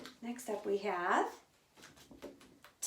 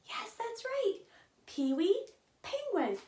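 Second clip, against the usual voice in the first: usual speaking voice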